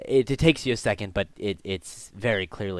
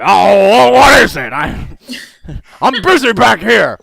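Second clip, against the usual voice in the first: loud, raised, irritated yell back; grumbling old dad; 11 semitones higher, 17 dB louder, slower than usual. Acted. Father interrupted at work, grumbling loud shout. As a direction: holler crossly from the workroom. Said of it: "he's grumbling".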